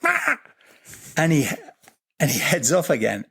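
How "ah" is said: sudden high-pitched eagle squawk; eagle cry. Retold or imitated. Imitating the eagle calling him to follow. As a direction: mimic the eagle calling out sharply. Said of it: "He looks and he goes".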